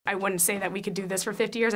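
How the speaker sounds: ordinary talk, nothing marked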